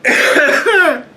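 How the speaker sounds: high, helpless burst of laughter; high giggle. Real, not acted. Cracks up retelling the story.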